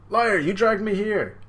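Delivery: loud high shout of blame; indignant accusation. Retold or imitated. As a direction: hurl the accusation. Crane accuses the rabbit.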